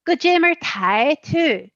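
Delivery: imitated Belfast Irish, high at the start; Belfast Irish accent. Showing a learner how Belfast asks "how are you", imitated Belfast accent. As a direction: jump up in pitch like a Belfast speaker. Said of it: "if it's in Belfast, they go".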